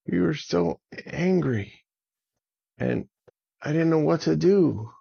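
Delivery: high-pitched, earnest pleading; earnest upset friend. Acted. Avon asks dragon about his outburst, earnest pleading. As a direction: let the hurt pitch the voice up.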